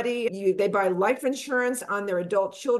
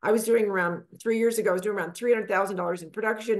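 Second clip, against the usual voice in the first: ordinary talk, nothing marked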